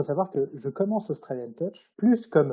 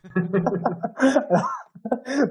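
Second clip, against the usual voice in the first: burst of laughter; 8 semitones higher than usual. Real, not acted. Laughs at the diary question.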